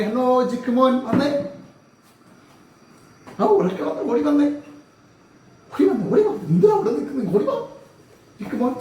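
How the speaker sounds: playful, high summons; calling someone over. Pretend. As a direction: coax a little friend to come running. Calling the puppet to come over.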